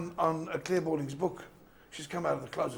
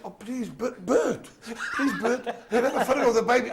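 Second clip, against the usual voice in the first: pleading fan; 6 semitones higher, 5 dB louder than usual. Retold or imitated. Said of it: "They say".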